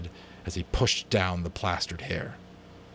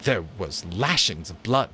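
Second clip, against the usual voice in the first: ordinary delivery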